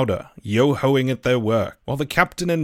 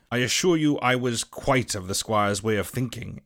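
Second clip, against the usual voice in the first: plain reading voice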